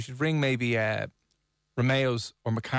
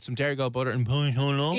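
mock French. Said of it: "you sound like an idiot".

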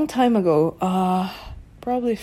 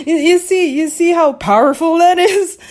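higher and louder, bursting with delight; real thrill, pitched up and loud; excited; 10 semitones higher, 6 dB louder than usual. Real, not acted. Telling viewers how powerful the spell is, excited and loud.

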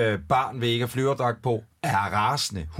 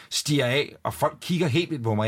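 normal talking voice

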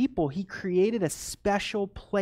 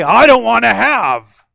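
loud, raised-pitch objection; mock refusal; 5 semitones higher, 15 dB louder than usual. Preacher voicing someone refusing fruit, mock objection. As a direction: push it away loudly, pitched up.

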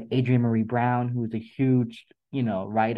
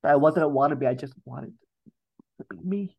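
moved, near tears; 3 semitones higher than usual. Real, not acted. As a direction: let the feeling rise as you say it again. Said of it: "I'm having an emotional day".

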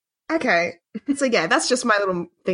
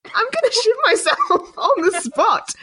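laughing; 10 semitones higher, slower than usual. Real, not acted. Laughing at imagined fright.

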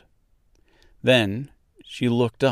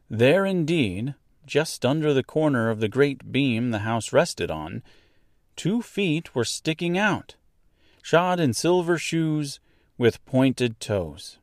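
usual speaking voice

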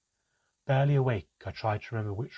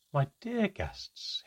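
higher, very slow and stately; formal elf greeting; 10 semitones higher, much slower than usual. Acted. Hinweisgeber welcoming guests, slow and stately.

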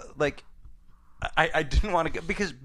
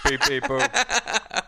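robot beeps; 9 semitones higher, much slower than usual. Retold or imitated. R2-D2 beeps repeated.

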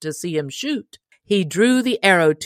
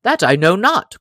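surly; 3 semitones higher, 7 dB louder than usual. Acted. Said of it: "quoth the stranger surlily".

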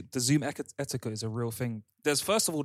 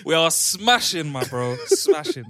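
laughing, loud and elated; excited; 5 semitones higher, 9 dB louder, much slower than usual. Real, not acted. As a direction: burst out with joy, slowly. Guest gushing about his good week.

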